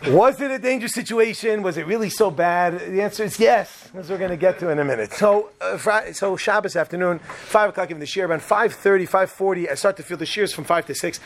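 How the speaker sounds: ordinary talk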